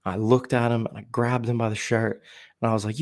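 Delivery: ordinary talk, nothing marked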